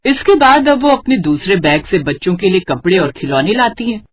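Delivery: ordinary delivery, nothing marked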